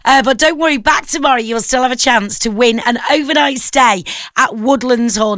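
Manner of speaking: ordinary talk, nothing marked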